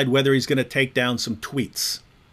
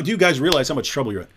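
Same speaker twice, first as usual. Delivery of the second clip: ordinary talk, nothing marked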